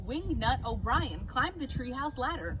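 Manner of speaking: plain reading voice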